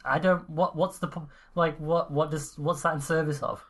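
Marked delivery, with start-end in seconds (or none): none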